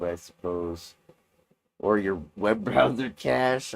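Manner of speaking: normal talking voice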